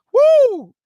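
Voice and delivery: loud high exultant whoop; joyful whoop. Real, not acted. Preacher exulting at his point, loud whoop. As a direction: let out a triumphant woo.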